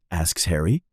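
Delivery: plain reading voice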